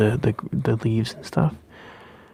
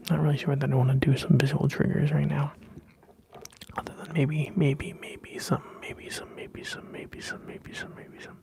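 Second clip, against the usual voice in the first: whispering; 3 semitones higher, 6 dB quieter than usual. Acted. ASMR whisper repetition.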